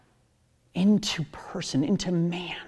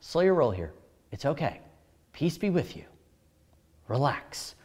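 calming; 4 semitones lower than usual. Retold or imitated. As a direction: settle frightened friends down gently. Jesus calming the scared disciples.

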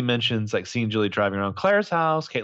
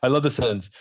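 ordinary talk

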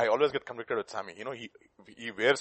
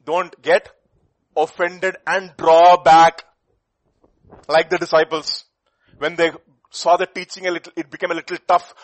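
preaching at full force; 3 semitones higher, 16 dB louder than usual. Acted. Preacher warning the congregation, loud and urgent. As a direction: press the point hard.

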